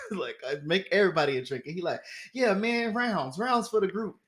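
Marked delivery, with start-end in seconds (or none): 2.3-4.2 higher voice, ordering the bartender; lightly voiced rich guy calling for drinks; bossy host. Stranger buying rounds.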